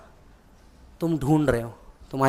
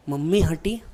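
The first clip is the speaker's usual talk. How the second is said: ordinary delivery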